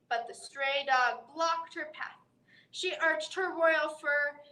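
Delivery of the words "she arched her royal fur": ordinary delivery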